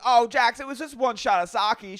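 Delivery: very high, loud whining plea; hammed-up wail of protest; Brittany. Wife defends her one shot to husband, mock wailing. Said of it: "And she goes".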